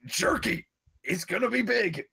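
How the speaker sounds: high, loud excited cheer for jerky; excited old grandpa. Pretend. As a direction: hype it like a sure bet. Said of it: "you're just like".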